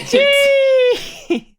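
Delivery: high, loud squeal of jeez; exasperated jeez. Real, not acted. Exasperated cohost squeals jeez at her gloating, high and loud. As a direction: cry out jeez at the gloating friend.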